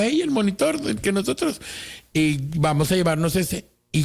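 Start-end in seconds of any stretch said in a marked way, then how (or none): 0.0-1.6 high-pitched retold call for attention. Customer claiming the monitor from others, calling out. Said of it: "yo digo".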